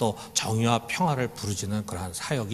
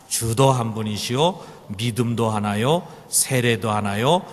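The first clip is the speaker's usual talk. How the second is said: slow, syllable by syllable reading; over enunciating. Shown as a demonstration. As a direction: read each word out clearly and deliberately. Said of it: "또박또박 한번 읽어봅시다". (또박또박 천천히 낭독하듯)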